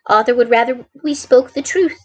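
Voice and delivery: ordinary delivery, nothing marked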